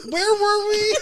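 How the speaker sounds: high, tearful words through laughter; real laughing-till-crying squeal; helpless laughter. Real, not acted. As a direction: try to speak while cracking up. Helpless laughter, trying to resume.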